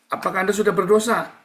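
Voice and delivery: high, loud challenge to the crowd; probing question. Real, not acted. Preacher loudly quizzing the congregation on sin. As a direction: call the question out to the hall.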